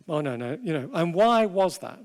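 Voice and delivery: normal talking voice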